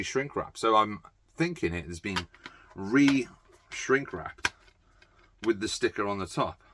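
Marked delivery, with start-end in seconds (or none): 2.7-4.5 slow drawn-out. Pretend. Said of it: "drawn out slowly".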